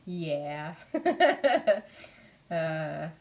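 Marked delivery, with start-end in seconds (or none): 0.8-2.1 high, loud burst of giggling laughter; short, loud peal of laughter. Real, not acted. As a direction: burst out laughing once more. Still amused by fire talk.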